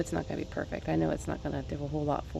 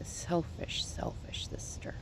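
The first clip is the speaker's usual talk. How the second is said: hushed, breathy self-reproach; whisper; 8 dB quieter, much slower than usual. Retold or imitated. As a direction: whisper it like a harsh inner voice. Inner voice calling herself selfish.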